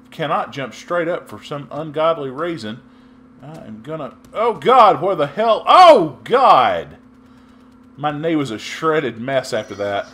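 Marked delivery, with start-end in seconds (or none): 4.3-7.0 high, loud "oh God"; startled scramble in the voice; panicked exclamations. Real, not acted. Gamer caught off guard in a fight, loud panic.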